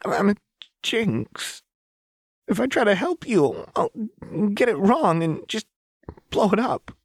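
put-on high, tearful girl voice; tearful child. A narrator's reading. Powder confessing to Silco, high and tearful. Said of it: "tears finally began to flow".

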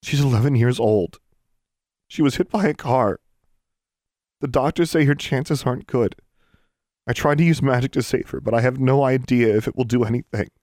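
put-on worked-up young caller; urgent. A narrator's reading. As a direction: plead the case urgently. Pleading for dying friend.

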